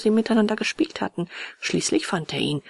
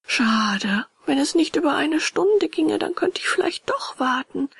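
put-on high, regretful boy puppet; wavering puppet boy; 9 semitones higher, 3 dB louder than usual. A narrator's reading. Puppet tempted to wait for coach, high wavering voice. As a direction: waver aloud in a raised boy's voice.

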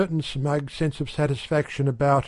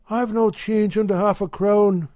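lightly higher wife's voice; a hint of a brisk housewife; Mrs Radford's voice; 8 semitones higher, 3 dB louder than usual. A narrator's reading. Wife telling the shopkeeper, lightly higher housewife voice.

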